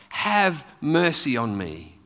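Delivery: ordinary delivery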